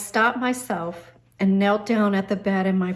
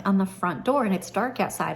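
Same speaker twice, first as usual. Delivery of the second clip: ordinary talk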